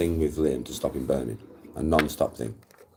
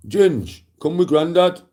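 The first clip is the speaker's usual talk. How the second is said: much higher, louder mock granddad call; imitating dad; 9 semitones higher, 5 dB louder than usual. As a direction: play dad calling the grandkid off the beach. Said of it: "my dad's gone".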